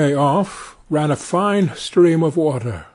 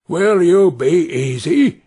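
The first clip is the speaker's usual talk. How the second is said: slow, louder, put-on wolf voice; wolf's irritated grumble; 3 semitones higher, 4 dB louder, slower than usual. The wolf scolds Tom for the clatter, a louder slow growl. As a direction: tell the noisy guest to hush, slowly. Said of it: "said the wolf".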